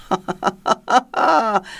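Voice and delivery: a string of ha-ha-has with a long last one; roaring laugh. A narrator's reading. Tyrant laughing at the weeping dwarf, roaring laugh. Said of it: "Rugit ce dernier".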